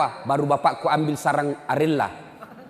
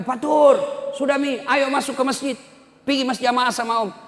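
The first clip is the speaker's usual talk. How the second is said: calling out; 8 semitones higher than usual. Retold or imitated. Uncle calling his nephew in from the football field. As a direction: call the boy in for prayer.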